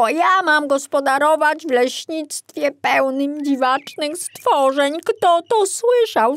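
put-on young forester fuming about the creatures; indignant young forester. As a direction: complain to the empty room. Piątek grumbling.

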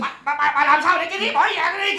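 loud, stammering accusation; angry blaming. Acted. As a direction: shout the blame at her. Husband blames wife.

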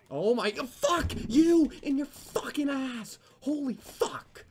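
very high, slow, loud screaming; full-on rage at the game; shrieking drawn-out gamer rage; furious scream. Real, not acted. Gamer raging after kill.

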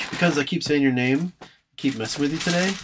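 usual speaking voice

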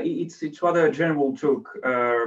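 normal talking voice

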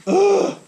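loud, high grunt of exasperation; frustrated groan. Real, not acted. Owner fed up with broken trailer, frustrated groan. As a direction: let out a sharp, loud "ugh".

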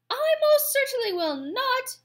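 very high, loud indignant squawk; squawking goose. A narrator's reading. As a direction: squawk a flat refusal. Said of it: "squawked the goose".

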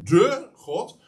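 "de" punched high and drawn out before "God"; stress on DE. Shown as a demonstration. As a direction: hit "de" in "de God" as a word of its own. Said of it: "dat zo even te benadrukken".

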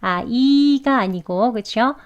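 usual speaking voice

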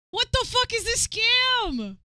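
high, loud outrage; outraged. Real, not acted. Streamer seeing the bakery prices, outraged. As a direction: yell at the scam.

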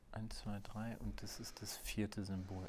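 counting "eins, zwei, drei" under his breath, unvoiced; whispered count. Real, not acted. Player recounting his card symbols alone, whispering.

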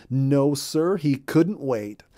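plain reading voice

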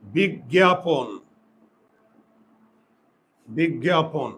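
"বিজ্ঞাপন" drawn out as big-gya-pon; dictated pronunciation. Shown as a demonstration. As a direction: sound out "বিজ্ঞাপন" very slowly, syllable by syllable. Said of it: "আর একটা উচ্চারণ লিখো".

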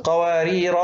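قوارير ending in long "raa"; alef kept. Shown as a demonstration. Teacher showing the first word stopped, long alef on قوارير. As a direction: stop on قوارير holding final "aa". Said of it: "تثبت".

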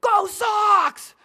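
high, loud Boston fan yell; hammed-up Red Sox fan cheer; fan shout. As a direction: bellow it from the back row. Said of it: "jumps up and goes".

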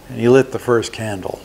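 ordinary delivery, nothing marked

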